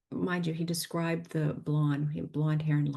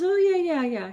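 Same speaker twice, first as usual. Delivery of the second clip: eager excitement; 12 semitones higher, 8 dB louder than usual. Retold or imitated. Psychic recognizes man, retold.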